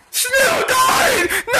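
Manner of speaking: high, wailing melodrama; mock anguished cry. T-Man finding the snail dead, mock anguished wail.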